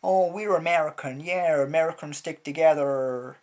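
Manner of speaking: loud, gushing mock American. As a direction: ham up a smug, cheering crowd, loudly.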